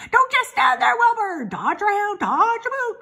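high, loud, frantic goose; urgent high-pitched goose cry; goose crying orders. A narrator's reading. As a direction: squawk out commands like a bossy goose. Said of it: "began to give orders".

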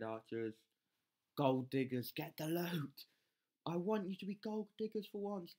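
ordinary delivery

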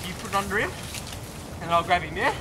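soft directions; hushed plan. Real, not acted. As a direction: keep it low while setting up the grab. Quiet instructions during the catch.

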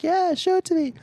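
squeaky high-pitched woman; high girl voice. Retold or imitated. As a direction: pitch way up for her. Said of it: "She's like".

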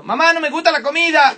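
put-on high, loud whining kid; whiny child. Comedian as a child complains about the food.

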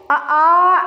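loud open "आ", all the breath let out; a breathy, open "आ"; long breathy aa. Shown as a demonstration. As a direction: push "आ" out with full breath. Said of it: "तो देखिए".

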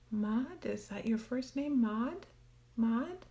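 ordinary talk

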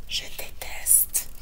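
whispered, slow; whispered confession. Acted. Ashley whispers that she hates it. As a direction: whisper it like a secret.

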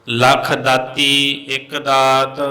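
slow, measured Gurbani chant; scripture recitation. Acted. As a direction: draw out the line endings, pause between phrases.

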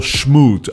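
"schmoot" with the S said as SH, slow; "schmoot", SH instead of S. Shown as a demonstration. Teacher showing learners the Dutch S, slow SH. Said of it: "turn your S's into S H's".

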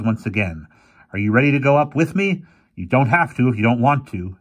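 lightly voiced determined old professor; rally them, brisk and grim.

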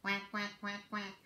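soft low quacks, a touch of a duck; mother duck quacking. Acted. Mother duck calling her ducklings, soft low quacks. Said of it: "Mother duck said".

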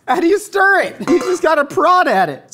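high, loud exasperation; flustered outburst. Real, not acted. Host struggles with an overfull pot, flustered outburst.

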